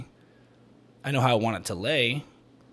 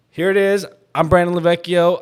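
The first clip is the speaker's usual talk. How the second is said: loud, higher show opener; host intro; 8 semitones higher, 13 dB louder than usual. Real, not acted.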